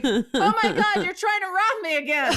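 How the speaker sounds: high, laughing mock outrage; outraged but cracking up; laughing accusation. Telling the scam caller off, high and laughing in mock outrage.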